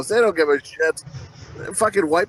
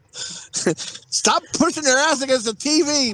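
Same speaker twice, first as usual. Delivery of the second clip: high, slow mock scolding; raised, drawn-out grandmotherly reprimand; 5 semitones higher, slower than usual. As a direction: imitate a grandmother calling out a telling-off. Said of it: "my grandmother would say".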